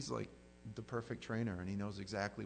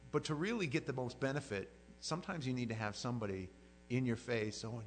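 ordinary talk, nothing marked

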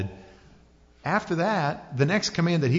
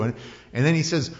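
ordinary delivery

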